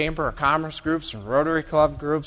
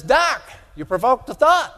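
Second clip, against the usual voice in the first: higher, louder folksy farmer voice; mocking Jim Bob; 7 semitones higher, 5 dB louder than usual. Texas cotton farmer addressing Doc, mocking folksy voice. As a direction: put on a hammed-up Texas farmer. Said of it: "as much as I'm mocking Jim Bob".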